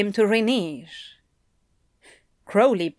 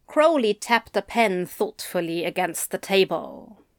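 usual speaking voice

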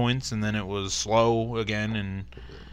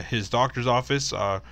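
ordinary talk, nothing marked

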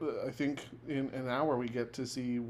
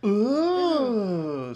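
loud, high crowd ooh of wonder; game show audience ooh; 8 semitones higher, 10 dB louder than usual. Retold or imitated. Imitating the Price is Right audience seeing the prize. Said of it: "said the Price is Right audience".

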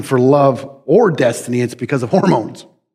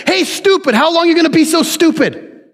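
high taunting call, much higher; mocking Wisdom; 13 semitones higher than usual. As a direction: call out to a fool teasingly. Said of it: "That's what it says".

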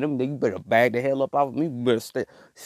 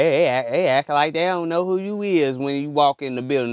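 usual speaking voice

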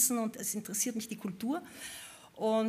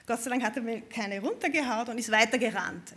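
ordinary talk

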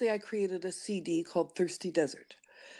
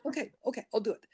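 higher, hurried okays, mock giving in; caving to the nagging voice, lightly played; 8 semitones higher, 5 dB quieter, much faster than usual. Self agreeing to the inner voice at last, mock surrender. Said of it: "And then you go".

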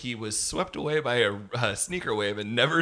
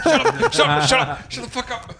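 laughing; 11 semitones higher, 8 dB louder than usual. Real, not acted. Teased, laughing protest.

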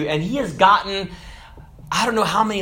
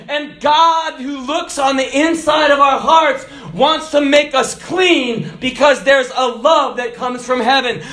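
high, loud preaching shout; preacher declaring God's love; full-force preaching; 8 semitones higher, 3 dB louder, slower than usual. Acted. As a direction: preach it out to the congregation.

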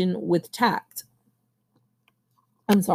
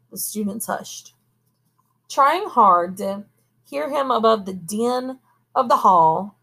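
plain reading voice

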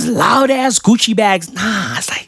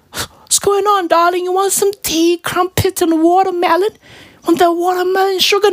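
high-pitched mock British hospitality; dainty British caricature offering tea; 8 semitones higher than usual. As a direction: play a fussy, sweet-talking British pop star. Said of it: "[name] would be like".

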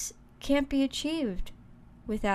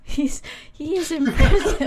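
breaking into laughter mid-line; can't keep a straight face, laughing through it; 4 semitones higher, 9 dB louder than usual. Real, not acted. Laughs while repeating.

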